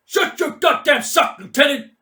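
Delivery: loud, high bellow of contempt; furious shout. Acted. Mad Mike berating the lieutenant, furious shout. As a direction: shout him down, full rage.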